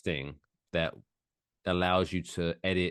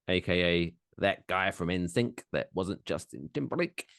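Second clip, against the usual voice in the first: usual speaking voice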